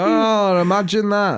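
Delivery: mock surprise. Dad feigns surprise at tooth fairy.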